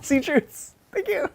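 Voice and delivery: very high-pitched, giddy exclamations; delighted outburst. Real, not acted. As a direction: cheer it out in squealing delight. Delighted reaction to the Talion question.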